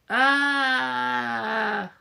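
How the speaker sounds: long high strained yell; long high loud shriek. Acted. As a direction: hold the cry out. Creature cries out.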